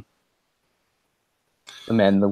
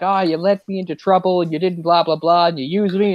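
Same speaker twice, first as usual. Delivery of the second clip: higher-pitched mock rant; imitating DiCaprio freaking out; 6 semitones higher than usual. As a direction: rattle off a retold angry complaint. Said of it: "DiCaprio's freaking out on him".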